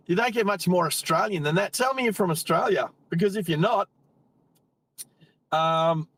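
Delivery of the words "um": normal talking voice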